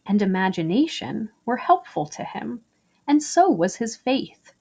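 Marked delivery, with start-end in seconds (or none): none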